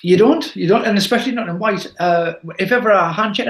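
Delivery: ordinary delivery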